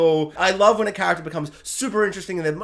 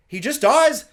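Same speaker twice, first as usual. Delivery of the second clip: higher and louder outburst; indignant repeat; 7 semitones higher, 5 dB louder, slower than usual. Real, not acted. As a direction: throw it out in disbelief.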